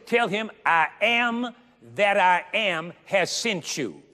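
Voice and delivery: high, loud and slow, preaching at full force; a preacher hammering home God's command; fervent preaching. Acted. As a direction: lift it up and drag out each word.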